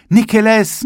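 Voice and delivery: high, loud schoolboy call of Nikeles; children calling. A narrator's reading. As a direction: shout Nikeles at the shop window. (Schulbuben rufen laut Nikeles)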